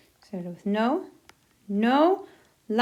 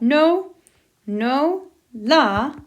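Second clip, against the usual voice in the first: No, no, la, loud and spaced apart; slow and loud; 4 semitones higher, 6 dB louder, much slower than usual. Shown as a demonstration. Teacher building the command piece by piece, loud. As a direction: build the phrase word by word, loud. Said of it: "put that before our verb".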